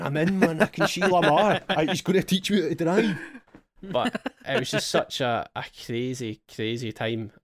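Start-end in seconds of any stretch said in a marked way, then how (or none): 0.0-3.2 high, loud, thrilled yelling; excited. Retold or imitated. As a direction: burst out in giddy triumph. Retelling getting into the game.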